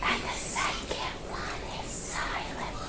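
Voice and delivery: slow start, then whisper the silent part; rule ending in a whisper. Shown as a demonstration. Teacher reciting the two vowel rule, whispering silent. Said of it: "When there are two vowels".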